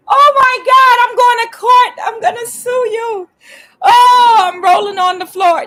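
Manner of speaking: mock hysterics, very high and loud; hammed-up wailing drama queen; hysterical overreaction. Mocks overdramatic pet owners.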